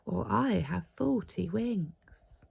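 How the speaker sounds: drowsy, fading into a whisper; sleepy. Acted. Sleepy Father Christmas dozes off among the elves. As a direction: let it sink toward sleep. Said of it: "said sleepy Father Christmas".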